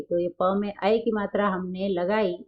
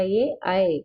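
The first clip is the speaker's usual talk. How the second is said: ordinary talk, nothing marked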